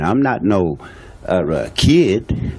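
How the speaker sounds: ordinary talk, nothing marked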